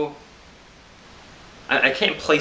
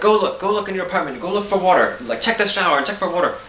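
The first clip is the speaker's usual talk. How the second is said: higher, louder, rushed neighbor with halting English; neighbor's voice; 8 semitones higher, 3 dB louder than usual. Retold or imitated. Imitating the neighbor insisting he look for water, higher. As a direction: urge him to go look, pushing in broken English. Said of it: "I'm trying to do his voice".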